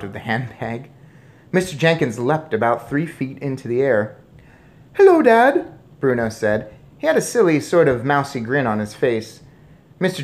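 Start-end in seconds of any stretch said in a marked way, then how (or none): 4.9-5.7 very high, loud squeaky greeting; mouse boy. A narrator's reading. Mouse boy greets shocked father, squeaking. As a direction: squeak it out cheekily.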